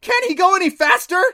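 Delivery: high, panicked scream; very loud shrieked plea. A narrator's reading. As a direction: scream the question in terror. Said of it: "Clancy screamed".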